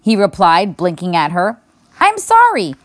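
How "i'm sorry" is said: the apology repeated higher and louder; repeated loudly. A narrator's reading. Girl repeats apology loudly to a half-deaf bear. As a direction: say it again so the big bear hears. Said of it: "she repeated loudly".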